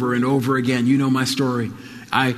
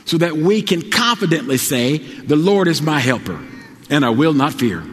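preaching at full force; 5 semitones higher than usual. Acted. Preacher to congregation, building to triumphant climax.

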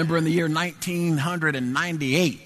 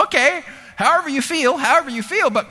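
imitated wife in a very high, raised voice; sweet wife; 12 semitones higher, 5 dB louder than usual. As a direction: placate him in a high-pitched mock-wife voice. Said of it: "she said so sweetly".

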